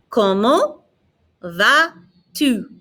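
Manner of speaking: "¿Cómo va tu?" word by word, silent T and S; silent final letters. Shown as a demonstration. Teacher models French for repeating, final letters silent. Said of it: "Notice I'm not pronouncing the T".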